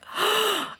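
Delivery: high, sharp gasp of astonishment; retold gasp. Mother reenacts hearing her daughter's first words, gasping. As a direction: suck in a breath, astonished. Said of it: "And I was like".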